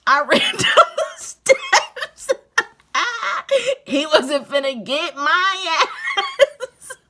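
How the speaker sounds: words squeezed out through high laughter, slow; cracking up as she tells it; laughing hard. Real, not acted. Telling friends how she fled, laughing through it. Laughing while retelling escape.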